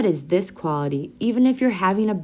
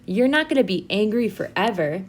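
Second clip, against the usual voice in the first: normal talking voice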